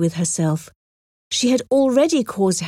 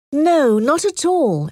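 firm; 6 semitones higher, slower than usual. A narrator's reading. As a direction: deny it firmly and slowly. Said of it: "Emma said firmly".

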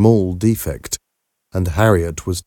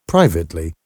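usual speaking voice

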